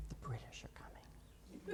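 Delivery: whispered, hushed warning; student's whisper. Retold or imitated. Retelling the homeschooled girl's answer, a whispered warning. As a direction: breathe it out like a secret. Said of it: "what did Paul Revere say when he was warning people".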